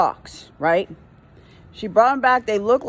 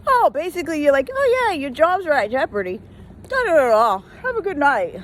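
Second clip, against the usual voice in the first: higher sarcastic retort; mock reply; 5 semitones higher than usual. Worker sarcastically answering a know-it-all customer, mocking. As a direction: throw her words back at her, mocking. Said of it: "I'm like".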